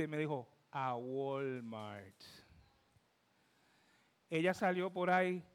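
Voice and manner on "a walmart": drawn-out reply. Retold or imitated. Retells wife's sheepish answer.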